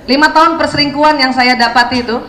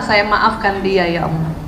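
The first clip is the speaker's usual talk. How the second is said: slow, soft, lower, a private plea; sincere prayer; 5 semitones lower, 5 dB quieter, slower than usual. Shown as a demonstration. Mother forgiving someone in prayer, slow and hushed. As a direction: pray it quietly as if alone with God. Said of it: "Maka bilang".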